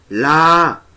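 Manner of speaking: sudden loud shout; jump scare shout. Acted. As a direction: yell it to make them jump.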